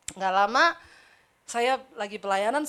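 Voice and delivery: ordinary talk, nothing marked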